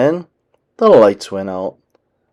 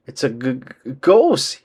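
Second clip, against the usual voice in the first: frightened stammer. A narrator's reading.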